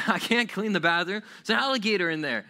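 high, loud hammed-up protest; mock-alarmed excuse. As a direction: protest as if a beast blocks the way. Child inventing excuse.

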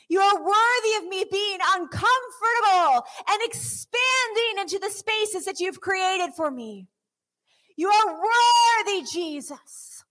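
high, loud fervent praise cried out; building worship cry; impassioned worship. Acted. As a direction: pour it out to Jesus at full voice. Preaching worship at full force.

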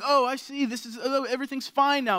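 high, loud voice of dawning understanding; relieved baby. Retold or imitated. Dad voicing his baby at the mirror, relieved. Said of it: "looking at himself going".

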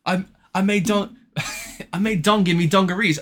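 a hammed-up cartoon British accent; mock British accent. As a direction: ham up a silly British bloke. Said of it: "laugh as a British person".

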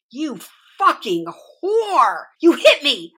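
high, drawn-out screaming accusation; screamed. A narrator's reading. Mother screaming at her daughter for hitting her, high shrieking. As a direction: shriek it as if just struck. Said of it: "she screamed".

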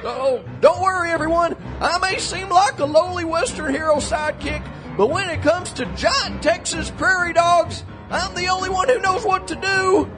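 high, ringing hero's speech; heroic proclamation. Acted. Sidekick daydreams himself the hero addressing everyone, grandly proclaiming.

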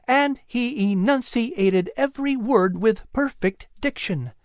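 high woman's voice, words heavily stressed; exaggerated emphasis. A narrator's reading. Sarah recounts the boy's retelling of the sermon, exaggerating for emphasis. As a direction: recount it proudly, leaning on each word. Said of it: "exaggerating each word for emphasis".

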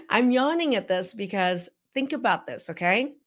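ordinary talk

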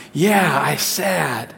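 put-on slow, drawn-out toddler pout; sad little son. Dad mimics son agreeing about the cookie, sad toddler voice. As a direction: imitate a sad child, stretching the words. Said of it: "You're sad because you don't".

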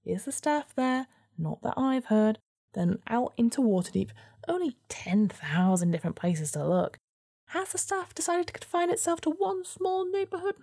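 high, sarcastic weasel voice; a grumbling little weasel. Acted.

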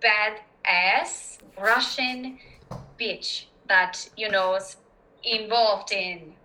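slow, deliberate Russian accent; Russian-accented English, drawn out. Shown as a demonstration. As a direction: put on a heavy Russian accent, word by word. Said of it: "my favorite way to get inside of a Russian accent".

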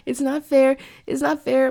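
high, pleading mock whine; hammed-up lament at temptation; tempted inner voice. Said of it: "the other half of me is like".

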